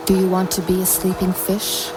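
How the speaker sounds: ordinary delivery, nothing marked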